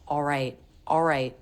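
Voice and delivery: "All right" said as "aw-right"; aw vowel for all. Shown as a demonstration. Teacher showing her own way, aw vowel for all. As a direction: make "All" an aw as in law, no L. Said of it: "just make an aw vowel".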